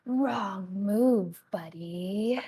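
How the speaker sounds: slow, drawn-out voice inside the head; amulet's voice. Acted. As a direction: draw it out as a taunting amulet spirit. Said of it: "you hear this voice in your head go".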